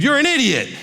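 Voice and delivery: high, sharp yelled insult; mock parent yell. Parent yelling an insult at the kid, high mock yell. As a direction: shout it down at the kid like an angry parent. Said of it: "Parent yells at the kid".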